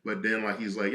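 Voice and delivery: usual speaking voice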